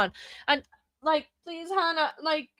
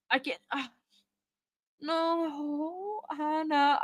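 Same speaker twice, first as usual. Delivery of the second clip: ordinary delivery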